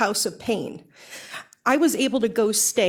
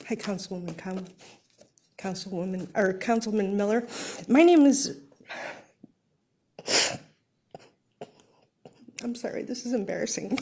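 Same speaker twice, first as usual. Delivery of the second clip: choked up; 3 dB quieter than usual. Real, not acted. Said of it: "I got choked up".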